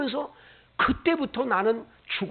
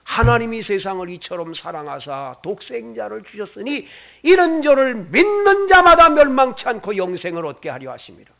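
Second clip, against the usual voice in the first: scripture recitation; 8 dB louder than usual. Acted. Reciting John 3:16.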